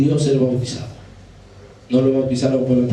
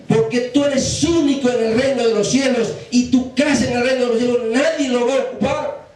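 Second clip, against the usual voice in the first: high, impassioned angel's lament, no real sobs; retold tearful plea in a raised preaching voice; imitated weeping angel; 10 semitones higher than usual. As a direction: plead upward as the grieving angel. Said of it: "el ángel ahí llorando dice".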